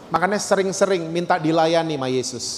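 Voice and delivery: usual speaking voice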